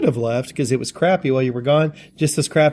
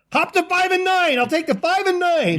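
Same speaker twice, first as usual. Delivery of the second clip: high-pitched mock table yell. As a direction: holler it across a casino floor. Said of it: "we just would yell out".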